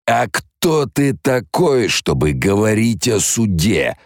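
put-on a cruel old man annoyed at a child; irritated domineering man. The possessing baron sneering at Leto, irritated and domineering. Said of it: "голосом раздражённого мужчины, властного, чувственного мужчины".

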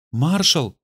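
mildly distraught exclamation; breaking voice. A narrator's reading. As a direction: say the name as if the voice might break. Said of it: "проговорила Кэт срывающимся голосом".

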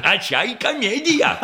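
high, laughing jeer; mocking laugh. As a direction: laugh at the whole comedy. Mocking the scene. (насмешливо, со смехом)

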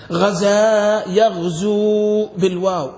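slow, stretched teaching forms; drawn-out example. Shown as a demonstration. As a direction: hold the long vowels slowly. Teacher demonstrates the root forms.

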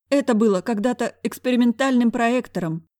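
faint sob. A narrator's reading. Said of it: "обморочно всхлипнул директор".

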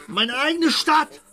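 rally himself up to a big proclamation; from firm to a loud triumphant shout; building to a shout. Acted. Building to grand announcement.

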